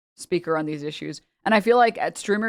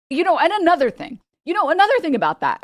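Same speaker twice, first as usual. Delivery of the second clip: much higher, louder, fast rambling lecturer; rambling Hassan; 10 semitones higher, 6 dB louder than usual. Retold or imitated. Teacher who can't stop ranting in class, high and fast. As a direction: spiral off on a tangent, voice up high. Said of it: "He'd be just sitting there like".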